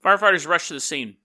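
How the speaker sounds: normal talking voice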